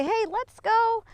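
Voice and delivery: high, drawn-out call to a dog; upbeat coaxing. Shown as a demonstration. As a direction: urge a lagging dog on brightly. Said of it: "I'm gonna say".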